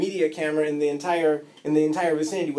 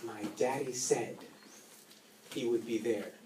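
closing sermon; 4 semitones lower, 5 dB quieter than usual. Acted.

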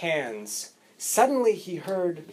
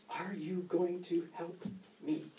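quiet plea; 13 dB quieter than usual. Retold or imitated. Father answers the firemen.

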